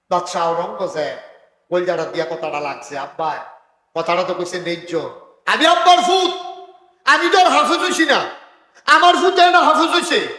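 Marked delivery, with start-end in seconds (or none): 5.5-10.3 high, loud chanted sermon cry; full-throated waz preaching; preaching at full force. Acted. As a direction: ring it out over the crowd.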